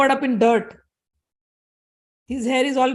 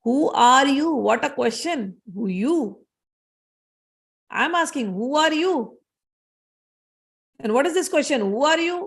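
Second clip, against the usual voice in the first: imitated mad person; 3 semitones higher than usual. Mad man mocking Parshuram's question, imitated mad voice. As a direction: toss questions back in a mock-mad singsong. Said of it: "The mad person is asking questions like this".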